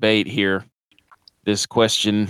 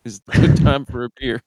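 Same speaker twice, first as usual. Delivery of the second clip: laughing echo; 4 semitones higher than usual. Real, not acted. Echoes co-host's line, laughing.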